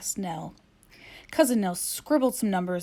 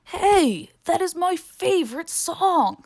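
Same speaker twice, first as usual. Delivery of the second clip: put-on excited teenager; 10 semitones higher, 6 dB louder, slower than usual. Teenager hears boo as music.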